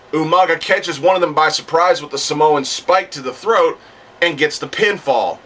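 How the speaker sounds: ordinary talk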